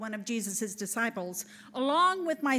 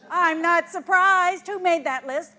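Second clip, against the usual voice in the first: indignant retort; 4 semitones higher, 10 dB louder than usual. Acted. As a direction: throw it back at him, offended. Indignant at being left off.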